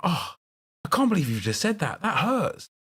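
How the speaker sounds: high, soft mock-wounded "that hurts". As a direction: play the hurt friend, hamming it up. Mock hurt reaction in story.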